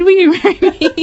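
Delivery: laughing as she retells him proposing; laughing proposal quote. Retold or imitated. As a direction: giggle while you quote the question. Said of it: "got down on one knee and said".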